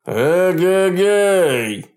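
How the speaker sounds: протяжный громкий богатырский клич; протяни клич, как былинный богатырь; двусмысленный крик. A narrator's reading. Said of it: "двусмысленно крикнул". (Кощей изображает богатыря, протяжно кричит)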